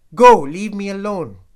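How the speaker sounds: high, very loud dismissal; furious shout. A narrator's reading. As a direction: bellow to drive him away. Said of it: "he shouted again".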